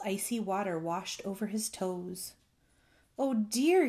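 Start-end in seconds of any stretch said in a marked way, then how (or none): 3.2-3.9 alarmed cry, higher and louder. A narrator's reading. Bullfrog finding a flood at his toes, crying out. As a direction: cry out in mild dismay. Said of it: "he cried".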